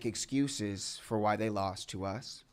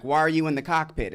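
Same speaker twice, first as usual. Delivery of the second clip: indignant challenge; 5 semitones higher, 7 dB louder, faster than usual. Retold or imitated. Acted challenge from those breaking in.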